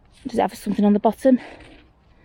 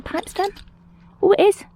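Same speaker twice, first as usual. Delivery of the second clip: high-pitched, giddy burst of hope; 12 semitones higher than usual. Real, not acted. Finder thinks she's spotted a pipe stem, giddy. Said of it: "Don't get giddy".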